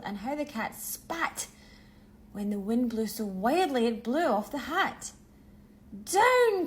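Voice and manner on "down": high, loud cry of "Down!"; sharp high call to land; cried out. A narrator's reading. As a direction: shout it to the broom. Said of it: "cried the witch".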